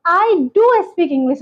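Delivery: slowly, leaning on do; stress on do. Shown as a demonstration. Teacher demonstrating emphatic do, very slowly. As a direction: do pressed hard in a slow I do speak English. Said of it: "ज़्यादा जोर दे रहे हैं".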